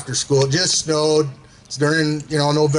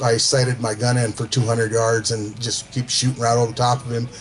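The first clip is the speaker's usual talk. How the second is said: usual speaking voice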